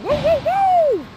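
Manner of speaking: high, slow mocking hoots; mock hooting. Vegan activist mocking the screams at the gate with high hoots. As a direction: hoot up high like a jeering animal.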